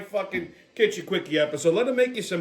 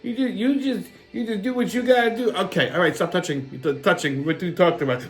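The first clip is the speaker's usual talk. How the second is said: ordinary talk, nothing marked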